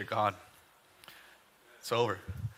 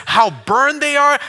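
loud mocking taunt; 7 semitones higher, 12 dB louder, slower than usual. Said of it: "He says".

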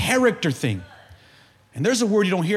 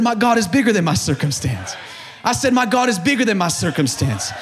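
fired-up pulpit crescendo; impassioned preaching; 6 semitones higher, 3 dB louder than usual. Real, not acted. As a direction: build from firm declaration to high shout. Preaches at full force.

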